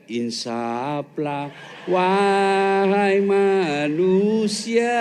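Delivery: very slow, drawn-out grand pronouncement; mock solemn preacher. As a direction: intone it slowly like a grave prophet. Mock solemn sermon line to wife. (pelan sekali, khidmat dibuat-buat)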